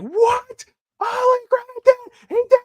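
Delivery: shrieking very high with disbelief; screaming at the TV in shock; retold scream. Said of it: "I screamed".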